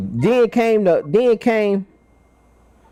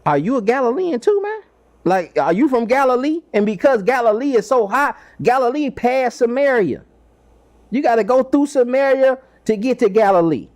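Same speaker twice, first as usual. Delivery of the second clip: a hint of a higher, scoffing voice; scoffing Pharisee; 6 semitones higher than usual. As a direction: lightly tease Nicodemus like a sneering Pharisee. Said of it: "And the Pharisee said".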